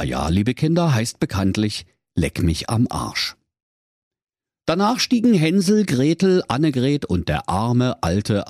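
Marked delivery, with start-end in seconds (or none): none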